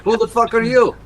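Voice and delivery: high loud demand; high shout. Acted. Characters trade the same shout.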